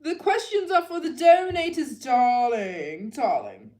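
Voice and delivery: high, loud, mock-haughty voice, drawn-out darling; sassy diva put-down. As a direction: play a snooty diva brushing off a freeloader. Teasing viewer who didn't donate.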